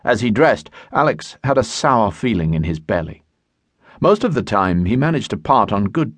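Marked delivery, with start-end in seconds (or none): none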